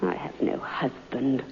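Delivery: low, slow and hushed; quiet confession. Acted. Daughter quietly admits to the visitor, hushed. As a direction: let the words sink, barely audible.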